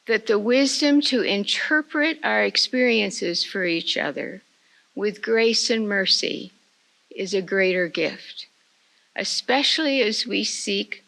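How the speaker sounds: usual speaking voice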